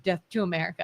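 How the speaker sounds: low, soft deadpan aside; muttered mock slogan. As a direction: slip it in under your breath as the mock delegate. Tacked-on slogan of mock delegate.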